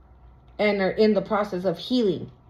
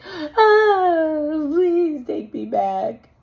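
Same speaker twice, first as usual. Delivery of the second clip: slow, hammed-up fake crying plea; mock pleading sob; 6 semitones higher, 4 dB louder, much slower than usual. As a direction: beg to be taken back in an over-the-top sob. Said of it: "Again, like I said".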